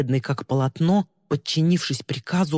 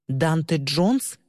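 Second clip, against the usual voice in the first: slow, drawn-out question; much slower than usual. Acted. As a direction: ask the name slowly, testing. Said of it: "спросила она".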